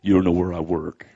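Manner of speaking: very low, glum imitated voice; mock complainer. Believer making excuses to the preacher, glum. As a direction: drop low to mimic an excuse-maker.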